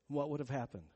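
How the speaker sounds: ordinary talk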